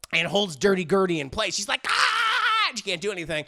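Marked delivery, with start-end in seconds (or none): 1.9-2.7 high loud shriek; a caught monster's high scream; trapped shriek. Retold or imitated. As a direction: scream as the vines hold you. Gertie trapped by vines.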